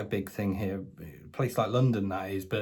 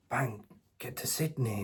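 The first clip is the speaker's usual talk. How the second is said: ordinary delivery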